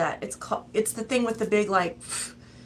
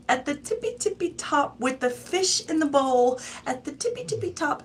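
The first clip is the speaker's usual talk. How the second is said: sing-song it like a nursery rhyme; singsong tippy top; 8 semitones higher than usual. Real, not acted. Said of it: "say it like that".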